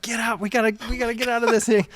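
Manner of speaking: fast, high, urgent yell; panicked shout. Retold or imitated. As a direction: bark the escape order in a panic. Fleeing sewage, telling Jen to get out.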